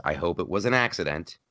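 ordinary delivery

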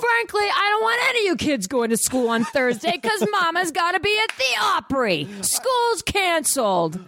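high, hammed-up proclamation; theatrical mom decree, stretched at the end; mock diva mama. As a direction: announce it grandly in a high put-on voice. Playing diva mom canceling school.

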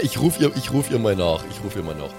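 ordinary talk, nothing marked

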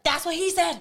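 high-pitched triggered retort; exaggerated high protest; mock outrage. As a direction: blurt it out high, as if having a flashback. Mock outburst at a familiar love line.

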